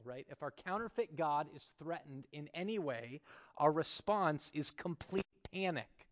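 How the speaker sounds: ordinary delivery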